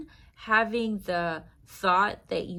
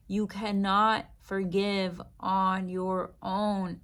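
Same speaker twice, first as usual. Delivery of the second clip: normal talking voice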